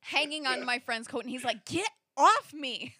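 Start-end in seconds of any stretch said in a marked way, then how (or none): 1.7-2.9 loud, drawn-out irritated command; mock exasperated yell; annoyed friend, imitated. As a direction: shake off a clinging friend, higher and slow. Acts out annoyed friend shaking her off.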